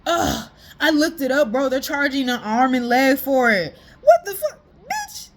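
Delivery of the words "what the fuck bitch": high, indignant squeal; squeaky disbelief at the price; much higher, outraged at the price; shocked outrage. Real, not acted.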